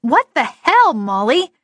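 loud, high startled demand. Acted. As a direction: snap it at her, alarmed. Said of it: "she demanded".

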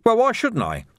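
high-pitched defensive retort; self-justifying protest, pitched high. A narrator's reading. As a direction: throw it back, voice jumping up. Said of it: "added defensively".

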